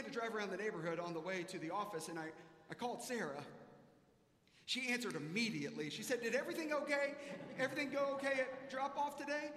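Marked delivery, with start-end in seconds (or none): 6.2-9.5 higher, louder eager question; eager to hear how it went; eager wife. Retold or imitated. Wife on the phone asking about drop-off, eager and higher.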